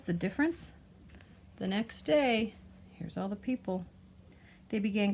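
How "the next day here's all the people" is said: ordinary delivery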